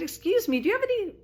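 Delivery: high, lilting polite request; retold tentative query, pitched well up; polite interruption. Customer interrupting a cashier on break, polite high voice.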